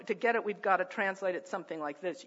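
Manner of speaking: ordinary talk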